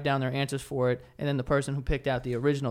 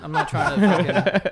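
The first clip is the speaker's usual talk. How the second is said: laughing; 2 semitones higher, 5 dB louder, much slower than usual. Real, not acted. Repeats himself, cracking up.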